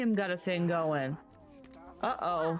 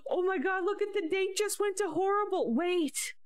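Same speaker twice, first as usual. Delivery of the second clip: high-pitched alarmed outburst; shocked; 11 semitones higher than usual. Real, not acted. Reacting to the date turning horrible, high shocked cry.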